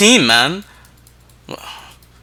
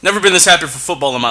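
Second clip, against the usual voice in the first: louder, punchy, worked-up delivery; excited hype; 3 semitones higher, 4 dB louder than usual. Acted. Hyping the new football league to co-host, excited and loud. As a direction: sell it loud like a hype man.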